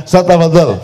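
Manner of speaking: normal talking voice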